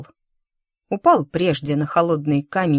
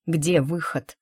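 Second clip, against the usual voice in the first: ordinary delivery, nothing marked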